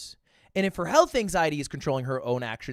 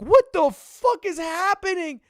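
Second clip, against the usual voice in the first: higher, louder, slow, stunned disbelief; baffled outburst; 12 semitones higher, 5 dB louder, slower than usual. Retold or imitated. Hearing they wrestled a guy for the purse, stunned disbelief. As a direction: stretch it out in total confusion. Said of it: "I'm like".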